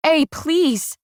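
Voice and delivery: high, desperate cry; high-pitched acted scream of pleading; screamed plea. A narrator's reading. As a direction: cry out, begging, pitch jumping up. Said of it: "I might have even screamed".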